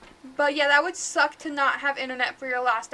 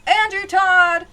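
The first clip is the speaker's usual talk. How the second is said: slow, drawn-out yell, louder and higher; yelling a name; 4 semitones higher, 10 dB louder, slower than usual. Real, not acted. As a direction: call the ghost by name at full volume. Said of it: "by yelling the guy's name out".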